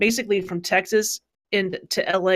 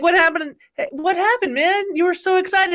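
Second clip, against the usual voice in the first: higher, worried "what happened, man"; alarmed concern; 9 semitones higher than usual. Retold or imitated. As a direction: ask in mock alarm, pitch up high. Retold alarmed reaction to friend.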